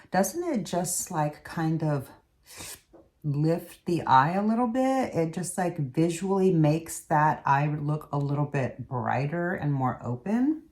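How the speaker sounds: ordinary talk, nothing marked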